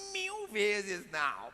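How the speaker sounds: slow, drawn-out melodramatic no; milk the no dramatically; dramatic denial. Acted.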